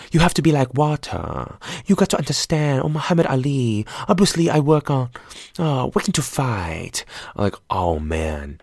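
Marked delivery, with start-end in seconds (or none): 0.1-7.1 high, exaggerated caricature Bruce Lee accent; mock Bruce Lee impression. Mocking the actor's Bruce Lee accent, high and exaggerated. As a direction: mimic a bad SNL-style Bruce Lee, pitched up. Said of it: "he kept on having the exact same pattern of inflection".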